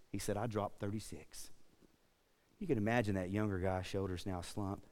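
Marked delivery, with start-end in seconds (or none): 0.4-1.5 quiet, low and gentle; a kind old man, hushed and lower; old woodsman's soft, kindly answer. Retold or imitated. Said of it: "in his gentle and kind voice".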